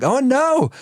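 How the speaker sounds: high, sudden dismayed cry; seeing the crash coming; dad's alarm. Retold or imitated. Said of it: "and I was like".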